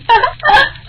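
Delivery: short high-pitched giggle; a quick gleeful laugh up high. Acted. Bahus giggle over their scheme, high giggle.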